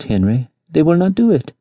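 put-on a spoiled child crying that it can't have its way; tearful stammer. Lady wailing to her husband Henry, tearful stammer. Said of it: "a voice full of tears, she stammered".

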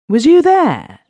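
astonished exclamation. A narrator's reading. Migsworth, startled to hear Smith went, exclaims loudly. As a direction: jump up in pitch in sheer surprise. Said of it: "Migsworth exclaimed".

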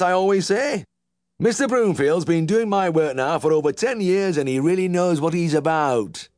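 very high Yorkshire twang, preachy; lecturing old farmer. A narrator's reading. Uncle lecturing the young vet, high twang. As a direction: lay down his homespun wisdom.

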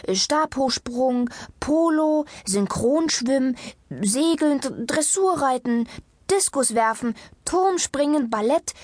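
high-pitched, escalating list of suggestions; eager listing. A narrator's reading. Doctor listing sports for her reluctant patient, eager. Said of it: "zählte Frau Doktor Sonnenschein auf".

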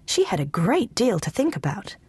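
usual speaking voice

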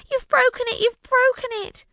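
high, upset shriek repeated. A narrator's reading. Rosalind shrieking at Alfred about the smashed melon. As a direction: wail it high in dismay. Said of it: "she shrieked".

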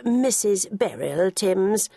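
slow, posh English "Missus Beryl Timms", rising at the end; top people's accent. Retold or imitated. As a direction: correct him in a haughty upper-class English accent. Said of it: "in a top people's accent".

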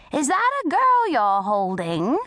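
put-on high, swooping old lady question; polite old lady. A narrator's reading. Old lady questions the bully, polite old lady voice. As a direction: ask politely, rising sweetly at the end. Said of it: "the old lady politely asked".

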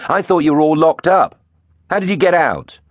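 high, loud-edged demand; alarmed questioning. A narrator's reading. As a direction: challenge them with pitch way up. Hero confronts escaped villains.

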